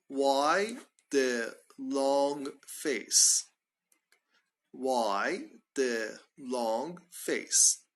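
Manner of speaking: each word drawn out slowly; slow demo question. Shown as a demonstration. Teacher modelling the phrase slowly for learners, very slow. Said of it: "We say".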